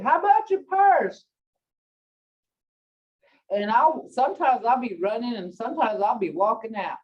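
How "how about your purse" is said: raised pitch, louder nagging question; mock husband asking. Husband reminding his wife, mock nagging question. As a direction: imitate the husband prodding. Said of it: "my husband is saying".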